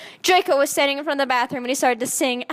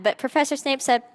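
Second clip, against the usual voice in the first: usual speaking voice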